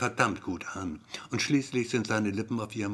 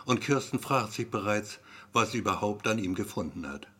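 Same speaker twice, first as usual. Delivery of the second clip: ordinary delivery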